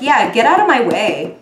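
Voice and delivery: loud, dismissive get-out; brushing off. Retold or imitated. Voicing reader brushing off petty people, loud. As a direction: wave them off firmly. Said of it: "This month it's like".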